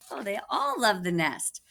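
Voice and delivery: plain reading voice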